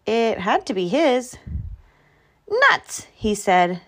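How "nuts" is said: high exclamation of frustration; high-pitched, thwarted outburst; dismayed. A narrator's reading. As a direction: blurt it as a mild curse. Groundhog sees his shadow, dismayed.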